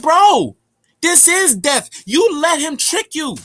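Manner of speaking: high, loud, hammed-up voice of the being; mock loud being voice. Mocking the speaker loudly, high and loud. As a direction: belt it out like a mocking cosmic figure.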